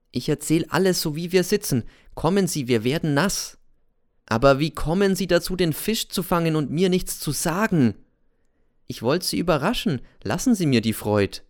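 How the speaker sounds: hoch und rasch, drängend; Cäsar, eilig beschwichtigend. Acted. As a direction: ein nervöser Alter, der abwiegelt. (Cäsar vertröstet den Lehrer im Regen, hoch und eilig)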